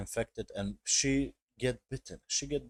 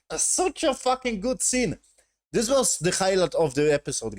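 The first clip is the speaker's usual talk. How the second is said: high, loud burst of fan enthusiasm; real excitement; 8 semitones higher, 5 dB louder than usual. Real, not acted. Fan raving about the episode's best scene, excited. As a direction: gush loudly, pitch leaping up. Gushing over favourite scene.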